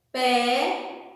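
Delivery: PEDESTRE split into separate syllables, pe, des, tre, with pauses; a teacher's slow "Pedestre", each syllable on its own. Shown as a demonstration. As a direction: count out "Pedestre" one syllable at a time. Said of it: "Um dedo pra cada sílaba".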